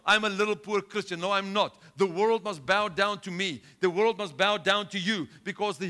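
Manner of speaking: loud, rising sermon declaration; fiery pulpit preaching; full-force preaching. Acted. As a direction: preach it out, pounding each claim.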